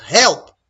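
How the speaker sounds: HELP short, loud and high; stressed HELP. Shown as a demonstration. Teacher trying ways to stress help, short loud HELP. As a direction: punch out a quick high HELP. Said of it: "Would I stress it by saying".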